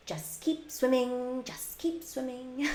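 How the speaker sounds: high, soft, slow character voice; Dory. Acted. Streamer showing viewers a voice impression, high soft Dory voice. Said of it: "the voice impression for Dory".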